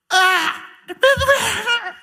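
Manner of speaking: high mock cry of pain with a laugh; hammed-up high pained yelp. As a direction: yelp like a bandage ripped off. Lazarus pulled bandage, pain.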